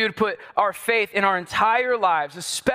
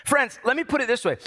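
ordinary talk, nothing marked

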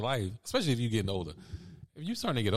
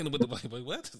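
ordinary talk, nothing marked

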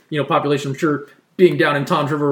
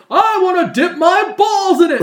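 high, loud, slow goofy catchphrase; milk the line like a dumb sketch character; higher, louder, drawn-out delivery of the catchphrase; 5 dB louder, slower than usual. Retold or imitated. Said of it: "He's like".

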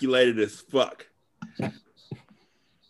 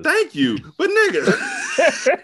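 high laughing squeal; 4 dB louder than usual. Real, not acted.

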